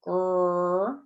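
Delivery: playful growled grrr like a bear; mock bear growl. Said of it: "This is the bear claw".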